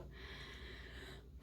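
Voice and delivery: quiet, soft sigh; a hopeful breath out before the wish. Real, not acted. Hoping the baby is fine.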